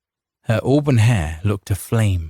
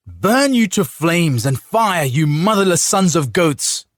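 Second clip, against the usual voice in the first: furious curse; 9 semitones higher, 3 dB louder than usual. A narrator's reading. Surrounded by lances, cursing.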